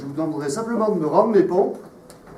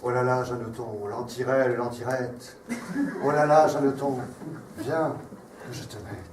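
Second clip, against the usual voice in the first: tragic declamation; 6 semitones lower, 5 dB quieter than usual. Acted. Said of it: "sur un ton de tragédie tel un empereur romain".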